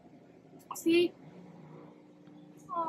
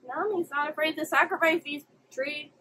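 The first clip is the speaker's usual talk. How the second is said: ordinary delivery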